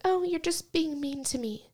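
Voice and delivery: high, soft imitation of a wounded mom; mock hurt complaint. Imitating mom saying she is mean, soft mock hurt. Mimics her mom's complaint.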